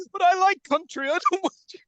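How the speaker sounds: high pleading hostage voice; mock scared hostage. Hostage pleading with the chimp at the wheel, high and panicky.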